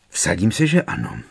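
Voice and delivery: plain reading voice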